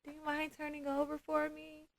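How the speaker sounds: high, soft polite ask; gentle waking request. Retold or imitated. Massage therapist asking a sleeping client to turn over, gently. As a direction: softly rouse someone without startling them.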